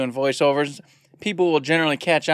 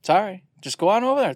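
ordinary talk

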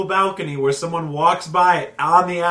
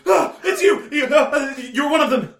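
high, panicked yelps; startled panic; 11 semitones higher than usual. Acted. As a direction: squeal in fright, then accuse. Startled by friend in cemetery.

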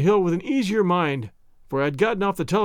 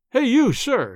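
an officer shouting hey at a walker; cried out; 6 semitones higher, slower than usual. A narrator's reading. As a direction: hail him sharply from the car. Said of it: "he cried".

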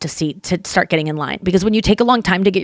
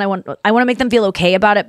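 ordinary talk, nothing marked

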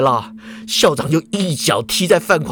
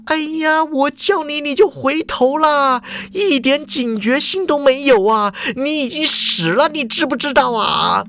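高调急促的责备; 像教官抓到学生失误那样训; 数落的校长; 9 semitones higher than usual. A narrator's reading. 校长数落范狂没警觉. (校长高声数落范狂一点警觉都没有)

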